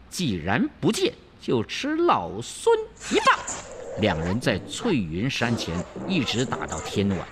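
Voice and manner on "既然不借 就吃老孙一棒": high, boastful monkey warning; high monkey king challenge; cocky Wukong. Acted. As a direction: taunt her before the staff swings. Wukong threatens.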